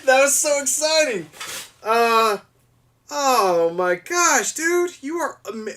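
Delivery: high, loud, with a laugh; moved to near-tears, gushing; teary excitement. Real, not acted. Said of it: "I kinda teared up a little bit".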